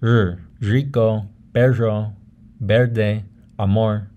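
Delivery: rico, perro, verde, amor with English r. Shown as a demonstration. Teacher shows the third R type, English-style. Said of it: "the R that you'll find in English".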